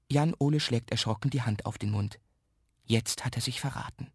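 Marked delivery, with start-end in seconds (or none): none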